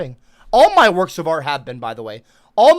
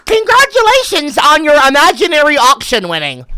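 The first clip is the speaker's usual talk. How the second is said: higher, louder mock congratulations; sarcastic; 9 semitones higher, 6 dB louder than usual. Real, not acted. Streamer mocking chat's imaginary auction win, sarcastic cheer. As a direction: drip fake applause on it.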